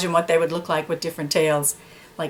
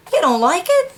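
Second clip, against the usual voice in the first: shocked; 10 semitones higher, 3 dB louder than usual. Acted. Shocked dog.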